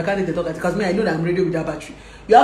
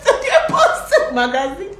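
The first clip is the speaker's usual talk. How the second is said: laughing through the words, slow; 9 semitones higher, slower than usual. Real, not acted. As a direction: giggle it out. Laughing at celebrity posts.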